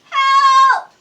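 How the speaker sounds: high, loud desperate yell; terrified shout; panicked cry for help. Acted. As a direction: scream for help as the boat tosses. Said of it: "cried the sailors".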